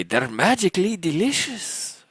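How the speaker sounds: put-on high, slow Irish accent for the ad slogan; mock Irish accent. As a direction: deliver the cereal slogan like a cheerful Irish leprechaun. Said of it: "but Irish".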